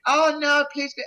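high imitation of the dealer's plea; mock pleading dealer. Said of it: "she keeps going".

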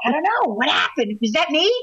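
high, playful disbelief; comic startled questioning; mock bewilderment. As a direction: act amazed that it came from you. Acting out baffled reaction, then laughing.

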